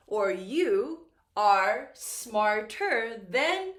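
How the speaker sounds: very slow and loud, each word set apart; teacher reading an example sentence slowly; slow model sentence. Shown as a demonstration. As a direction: dictate it slowly for the class. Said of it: "I can say".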